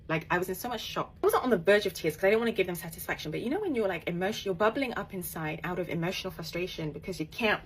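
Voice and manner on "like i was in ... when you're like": usual speaking voice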